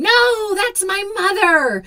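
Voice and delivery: high shrieking cry of protest; ghostly scream. Acted. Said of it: "filled with a scream".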